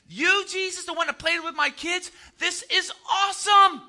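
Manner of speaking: high, building excitement; thrilled townsfolk. Acted. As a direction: act amazed, rising to an exclaimed finish. Townsfolk thrilled about Jesus.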